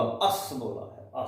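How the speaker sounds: अस with no vowel after the स; half s. Shown as a demonstration. Teacher shows the class how अस ends on a bare स. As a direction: stop on the स in अस. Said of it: "यहां हमने असा नहीं बोला".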